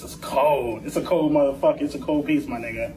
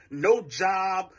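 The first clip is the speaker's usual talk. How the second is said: ordinary delivery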